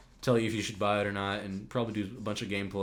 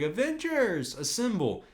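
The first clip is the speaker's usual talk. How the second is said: a hint of a hero rallying, pitched high on Avengers; lightly hammed-up battle cry; mock heroic call; 10 semitones higher, slower than usual. Mocking Captain America's rallying call, lightly hammed. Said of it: "He's like".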